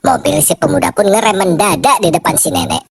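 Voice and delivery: normal talking voice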